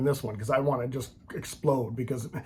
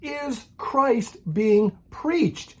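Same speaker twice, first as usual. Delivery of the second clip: slow Paul quote; 8 semitones higher, much slower than usual. Retold or imitated. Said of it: "He said".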